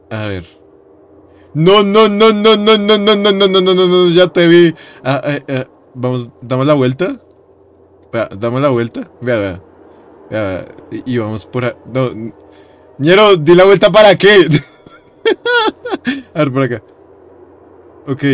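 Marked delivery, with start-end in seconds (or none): 1.5-4.7 loud, high volley of no's; panicked refusal. Real, not acted. Spots the enemy, panics. 13.0-14.5 loud, high complaint; exasperated outcry. Real, not acted. Exasperated at pointless turn.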